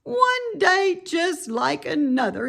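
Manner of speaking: put-on young pig groaning at the rain. A narrator's reading. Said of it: "he groaned".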